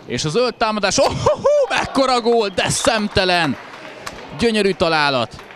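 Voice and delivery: high, loud shouted goal call; excited hungarian sports commentary. Real, not acted. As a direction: scream it with delight. Goal scored in live commentary. (izgatott magyar sportkommentár)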